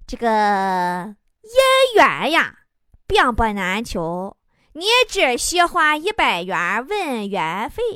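slow, drawn-out soothsayer patter; fortune-teller. Acted. Fortune-teller selling a love reading to a young man, slow chanting drawl. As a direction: stretch each phrase like a street mystic. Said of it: "就听见有个算命的呀".

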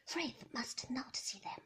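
plain reading voice

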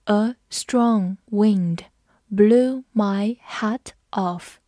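very slow, careful classroom read-aloud; teacher modelling the sentence slowly. Shown as a demonstration. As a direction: drag each word out for learners. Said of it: "好，我们慢读一遍。".

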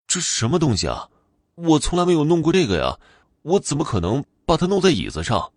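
high-pitched startled denial; taken aback, protest your innocence; astonished, voice jumping up. Acted. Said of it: "大吃一惊".

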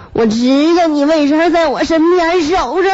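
high, slow, rising mock wail; tearful accusation. Feverish wife suspecting husband's motives, slow mock wail. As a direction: let the fake crying climb to the end. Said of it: "我一边哭一边说".

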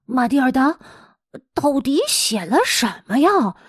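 ordinary delivery, nothing marked

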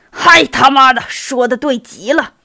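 loud, forceful burst of agreement; excited shout. A narrator's reading. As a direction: shout it out, jumping in. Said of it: "杰尼索夫跳起来喊道".

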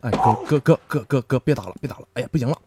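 soft, pleading yelps; mock begging. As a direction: beg to be spared, hammed-up. Begging while being beaten.

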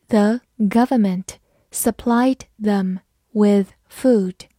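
slow, careful word-by-word reading. Shown as a demonstration. Teacher reading a model sentence slowly for students. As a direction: take it slowly, one word at a time. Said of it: "好，慢慢来读。".

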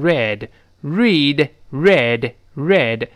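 "read" drawn out, said like red; past-tense pronunciation. Shown as a demonstration. Teacher models past-tense "read" for students, slow clear pronunciation demo. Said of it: "但读的时候读成".

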